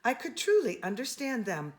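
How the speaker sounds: ordinary delivery, nothing marked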